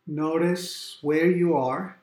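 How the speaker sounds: normal talking voice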